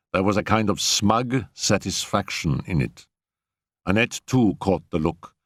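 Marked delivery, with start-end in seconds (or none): none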